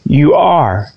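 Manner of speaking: ordinary talk, nothing marked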